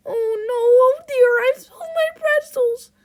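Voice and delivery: high, loud, tearful clown wail; distressed clown. Acted. Clown upset over spilled pretzels, high hammed-up wail. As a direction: cry out in cartoon dismay.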